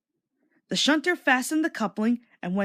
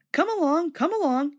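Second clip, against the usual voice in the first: eager higher chugging call; puffing; 7 semitones higher than usual. A narrator's reading. Thomas urging the trucks along, puffing eagerly. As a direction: puff it out eagerly. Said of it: "he puffed".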